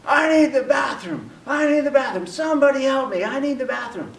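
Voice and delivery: high-pitched, urgent mock scream; high mock shout of desperation; retold screaming. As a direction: cry out for help, imitating a desperate woman. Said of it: "she's screaming".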